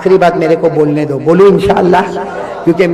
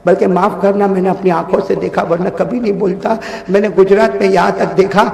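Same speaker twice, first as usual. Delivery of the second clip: ordinary talk, nothing marked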